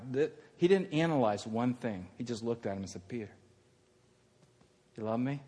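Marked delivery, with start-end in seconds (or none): 3.1-5.4 soft, low, tender asking; gentle question. Acted. Jesus asking Peter do you love me, gentle. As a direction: ask softly and leave it hanging.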